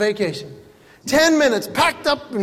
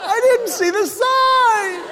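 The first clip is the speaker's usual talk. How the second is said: high drawn-out mock scream; hammed-up terror, shouted at a very high pitch; panicked driver; 13 semitones higher, slower than usual. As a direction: scream in exaggerated alarm as a clueless driver. Acting a panicked, clueless driver.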